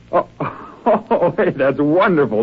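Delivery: laughs in relieved disbelief; a gleeful chuckle through the words; laughing. Acted. Jerry laughing at the news of the murder.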